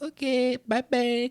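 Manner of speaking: high, sing-song waiter voice; waiter impression. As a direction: imitate a waiter in a high, drawn-out lilt.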